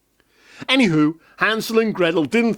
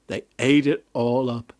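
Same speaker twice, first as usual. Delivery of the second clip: ordinary delivery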